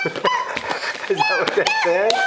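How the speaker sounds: very high excited shrieking; excited squeal. Real, not acted. Playful high squeal at toddler.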